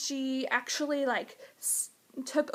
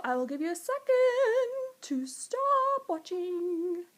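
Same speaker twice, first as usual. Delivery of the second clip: singsong warning; 5 semitones higher, slower than usual. Real, not acted. Spoiler warning to viewers, singsong.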